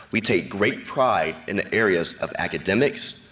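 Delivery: normal talking voice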